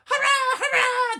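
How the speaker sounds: very high, loud wild cheer; roaring cheer. A narrator's reading. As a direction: whoop as the rope snaps. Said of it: "they roared".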